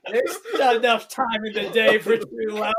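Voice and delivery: high, loud, laughing line. Real, not acted. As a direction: laugh through the words. GM laughs at player's choice.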